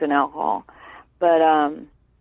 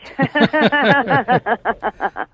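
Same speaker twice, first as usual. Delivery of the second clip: long run of higher-pitched chuckles; rueful laugh; 5 semitones higher than usual. Real, not acted. Brother laughs at how badly he handled it, laughing. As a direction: laugh at your own sarcasm. Wry laugh at own sarcastic remark.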